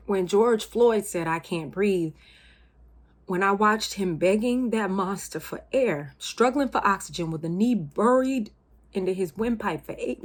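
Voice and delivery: loud, impassioned account of injustice; mounting outrage. Acted. Worker recounting a killing to colleagues, loud outrage. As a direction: let the outrage push every phrase out hard.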